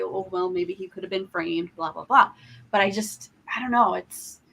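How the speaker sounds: ordinary talk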